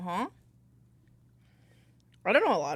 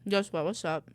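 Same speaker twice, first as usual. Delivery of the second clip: talking with mouth full; chewing while talking; 4 dB quieter than usual. Real, not acted. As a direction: speak through a mouthful of rice. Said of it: "sorry if you can hear me eat".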